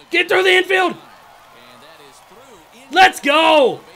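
high, loud cheering for the hit; real game hype, shouting; excited shout. Real, not acted. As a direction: yell it through the infield.

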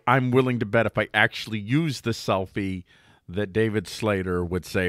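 ordinary talk

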